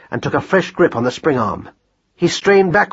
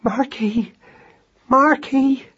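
high, frightened little call; small anxious wife's voice; frightened whisper; 11 semitones higher, much slower than usual. A narrator's reading. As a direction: call to your hurt husband in high-pitched dread. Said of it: "she whispered through chattering teeth".

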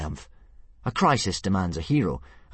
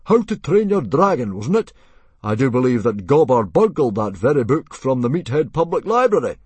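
loud, high, pointed remark; booming chief needling a rival; knowing chief Stoic; 7 semitones higher, 9 dB louder than usual. A narrator's reading. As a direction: say it pointedly for the room to hear. Plays Stoic recalling the book.

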